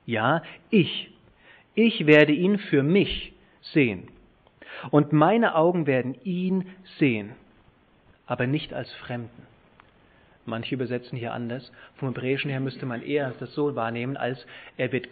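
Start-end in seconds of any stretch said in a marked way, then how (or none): 0.1-9.4 doubled "ich" stressed, pause between, the second louder and drawn out; emphatic scripture reading. Shown as a demonstration. Preacher reading Job seeing God himself, deliberately emphatic. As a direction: hit "ich werde ihn für mich sehen" slowly and forcefully. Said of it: "ich betone jetzt das".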